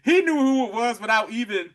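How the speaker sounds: ordinary delivery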